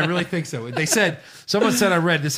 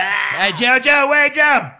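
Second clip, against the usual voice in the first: loud, high calling out; wrestling promo shout; 7 semitones higher, 7 dB louder, slower than usual. Retold or imitated. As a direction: yell after him to wait.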